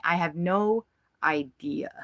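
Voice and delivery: breathy whispered aside to the camera. Real, not acted. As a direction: let it slip out as a secret aside. Aside about birds listening.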